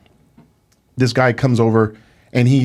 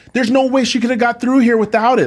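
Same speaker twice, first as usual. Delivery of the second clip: higher-pitched exasperated protest; 8 semitones higher than usual. Retold or imitated. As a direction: argue back in disbelief, pitched up. Acted protest at airport screeners.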